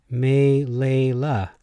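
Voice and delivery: "Melela" as slow may-lay-LAH; stress on last syllable. Shown as a demonstration. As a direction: draw out "Melela", landing on LA. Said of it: "put a different emphasis on words".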